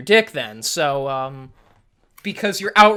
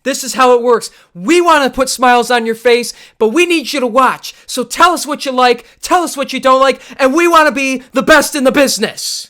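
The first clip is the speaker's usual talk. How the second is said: pumping up the audience like a wrestling promo; 3 semitones higher than usual. Pretend. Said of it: "like, a wrestling promo".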